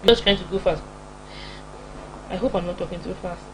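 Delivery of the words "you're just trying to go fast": ordinary talk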